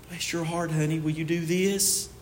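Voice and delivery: mock-gentle, soft and low coaxing; hushed, low, timid request; ineffectual sweet-talk. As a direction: ask meekly, as a coach who won't yell. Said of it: "It's not very effective if you".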